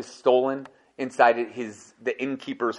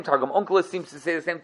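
normal talking voice

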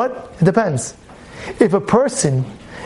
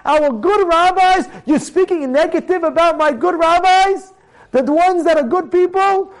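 high, incredulous challenge; acted outrage in rising questions; indignant anger; 6 semitones higher than usual. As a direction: confront someone who insulted your teachers. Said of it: "That's when the anger comes out".

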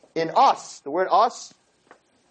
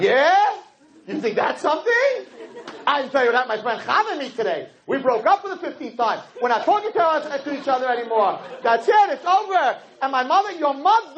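much higher, overwrought complaining; wife outdoing his story, much higher and hammed-up; melodramatic wife; 5 semitones higher than usual. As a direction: play the drama queen. Wife topping husband's bad day.

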